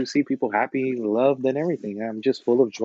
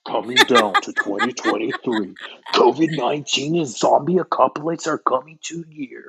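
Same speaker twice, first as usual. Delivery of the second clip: preview guy voice. Acted. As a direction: boom it out like a trailer. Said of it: "You can do the previews too".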